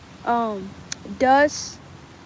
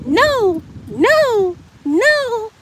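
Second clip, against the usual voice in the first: put-on toddler saying no; 8 semitones higher, much slower than usual. Toddler niece refusing, voiced.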